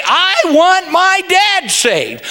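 high, slow, insistent plea; bold plea. Acted. Preacher showing how to ask God, bold plea. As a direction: plead it outright, drawn out and high. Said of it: "Go ahead and say".

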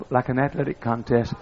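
normal talking voice

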